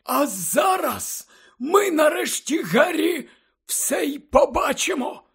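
very high, excited character voice; eager headmaster. A narrator's reading. Dumbledore urging Harry to see the memory, eager. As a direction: urge him on in a high, drawn-out voice.

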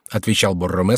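plain reading voice

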